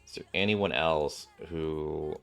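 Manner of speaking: normal talking voice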